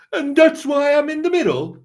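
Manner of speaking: high, loud put-on British accent; mock British accent. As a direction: sing out a mocking British accent. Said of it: "Nice accent".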